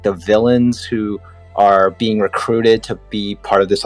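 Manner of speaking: ordinary delivery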